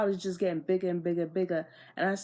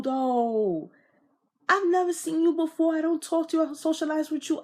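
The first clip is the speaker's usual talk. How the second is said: high, loud, indignant ranting; heated rant; 7 semitones higher, 3 dB louder than usual. Retold or imitated. Retelling confusion at a stranger's claim, loud and indignant. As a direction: fire off the questions in outrage.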